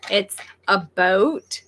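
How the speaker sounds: slow "boat", close to about with a long o; Canadian "about". Shown as a demonstration. Settling how Canadians say about, slow long-o "boat". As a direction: stretch out "boat" as the demonstration. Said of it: "It's closer to".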